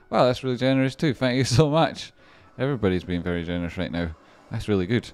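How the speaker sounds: usual speaking voice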